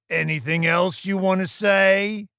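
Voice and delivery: loud, high, pointed question; challenging boss. Acted. Boss asking Tyler for anything else, loud challenge.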